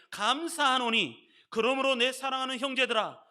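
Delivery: high, booming scripture declaration; loud proclamation. Real, not acted. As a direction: cry it out from the belly, stretching the phrases. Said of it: "큰 소리로 한번 읽어보겠습니다". (배에 힘주고 선포하듯 크게 외치기)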